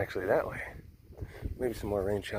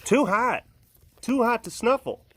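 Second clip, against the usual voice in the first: pet's voice; 12 semitones higher, 9 dB louder than usual. Pretend. Voicing the pet.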